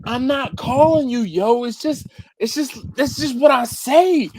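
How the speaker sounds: high-pitched, pleading self-defense; exasperated explaining. Retold or imitated. Explaining his yo to coworker, high-pitched exasperated protest. Acted quarrel, explaining himself.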